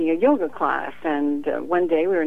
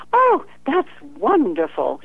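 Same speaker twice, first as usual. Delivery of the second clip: mock delight; 8 semitones higher, 3 dB louder than usual. Client's cheery reply, mocked.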